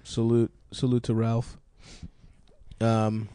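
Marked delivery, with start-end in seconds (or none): none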